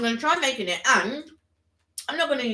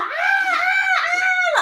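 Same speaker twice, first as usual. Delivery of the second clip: high shrill mock scream; imitated screams; 5 dB louder than usual. Friend imitates the girl screaming at the spider, mock scream. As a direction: shriek ah like the girl in the shower. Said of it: "And she's like".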